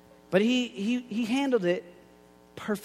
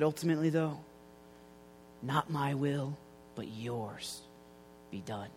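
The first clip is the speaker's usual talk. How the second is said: quiet surrender; 6 semitones lower, 11 dB quieter than usual. Retold or imitated. Jesus prays in Gethsemane.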